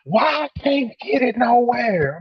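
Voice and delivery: put-on high, slow whiny girl voice. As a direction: whine it like a spoiled customer. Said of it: "She was some".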